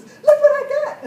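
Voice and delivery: high, loud excited shriek; a neighbor squealing over her new blender. Retold or imitated. A neighbor squealing over her new blender, excited shout. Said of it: "She was all excited".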